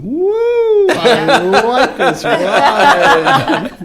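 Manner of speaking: high, loud and slow stoned woo; blissed-out spaced-out guest; stoned rider. Retold or imitated. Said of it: "people came out of there going".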